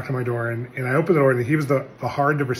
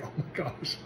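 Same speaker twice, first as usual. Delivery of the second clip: breathy stunned whisper; whispered oh my gosh; 12 dB quieter than usual. Retold or imitated. Speaker hearing the young man's word about pride, whispering in shock. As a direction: gasp it under your breath, caught out. Reacts to the rebuke.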